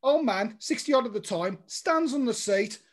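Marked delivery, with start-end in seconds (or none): none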